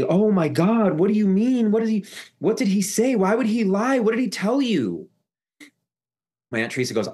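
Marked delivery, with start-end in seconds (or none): 0.0-5.1 high-pitched mock distress; high, put-on wail of protest; imitated frantic Annie. Imitates aunt panicking on phone.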